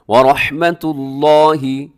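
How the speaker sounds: slow, loud recitation of रहमतुल्लाहि with a thick, dark ल्लाह; tajweed recitation; heavy Allah. Shown as a demonstration. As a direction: press the heavy ल्ला. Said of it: "लफ्ज़ अल्लाह मोटा पढ़िएगा".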